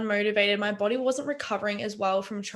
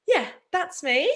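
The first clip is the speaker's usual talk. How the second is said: high, slow, tearful wrap-up into laughter; emotional; 8 semitones higher, 5 dB louder than usual. Real, not acted. Speaker ending her personal story, emotional. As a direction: wrap up choked up, about to laugh. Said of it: "Sorry about getting emotional".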